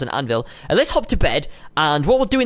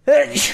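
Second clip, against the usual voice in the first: sudden sharp sneeze; 8 semitones higher than usual. Real, not acted. Player with an allergy to pollen, a real sneeze. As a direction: let out a quick sneeze. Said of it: "I need to sneeze as well".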